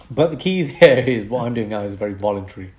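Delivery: ordinary delivery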